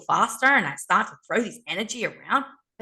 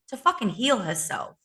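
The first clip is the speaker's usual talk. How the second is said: ordinary talk, nothing marked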